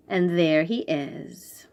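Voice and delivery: ordinary delivery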